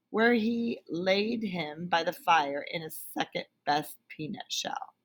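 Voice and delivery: plain reading voice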